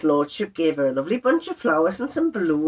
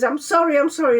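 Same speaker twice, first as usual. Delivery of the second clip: higher-pitched mock upset apology; imitating tearful apology; 7 semitones higher than usual. Wife imitates upset husband apologising, tearfully. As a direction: repeat it pleadingly, as if upset. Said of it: "he started crying".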